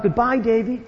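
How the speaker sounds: high-pitched mock shout, not full volume; retold calling out. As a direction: imitate an old tramp calling out a farewell. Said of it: "he shouted out".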